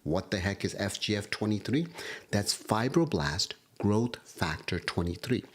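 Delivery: normal talking voice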